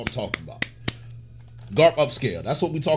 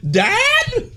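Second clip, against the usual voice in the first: very high, incredulous squeal; amused, high-pitched disbelief; laughing disbelief; 4 dB louder than usual. Real, not acted. Incredulous laughing repeat of line.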